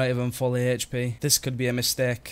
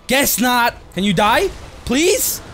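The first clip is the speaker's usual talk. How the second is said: high loud pleading; desperate; 10 semitones higher, 6 dB louder, slower than usual. Real, not acted. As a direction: beg the boss to die. Desperate mid-fight.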